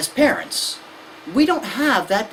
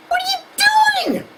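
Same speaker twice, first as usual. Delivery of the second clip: exasperated parent; slower than usual. Retold or imitated. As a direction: yell in disbelief. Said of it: "you're like".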